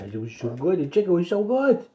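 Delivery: high, cooing sing-song about the chicken. Real, not acted. Father coos to his little one about breakfast, high baby talk.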